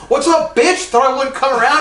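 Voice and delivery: loud, high mock challenge; imitated aggressive taunt. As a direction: burst in taunting, hammed up. Acts out gunman's taunt.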